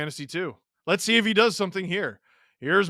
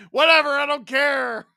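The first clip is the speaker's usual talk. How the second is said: gleeful dismissal; 9 semitones higher, 4 dB louder than usual. Real, not acted. Brushes off mistake after celebrating.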